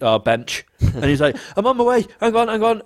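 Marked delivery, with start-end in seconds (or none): 1.6-2.8 high, put-on urgency; acted high-pitched stalling; mock hurrying. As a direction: pretend to be rushing, voice pitched up. Pretending to run on phone.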